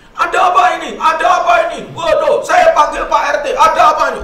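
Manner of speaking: high, loud panicked shouting; shrill-pitched, full-volume demand; alarmed wife. Retold or imitated. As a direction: imitate a wife raising the alarm. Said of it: "istrinya di belakang dia langsung".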